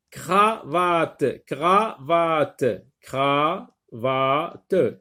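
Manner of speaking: "cravate" broken as cra, vate, then cra, va, te; held, separated beats on "cravate"; syllable by syllable. Shown as a demonstration. Teacher tapping syllables for pupils, "cravate" cut into distinct beats. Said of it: "frappe les syllabes".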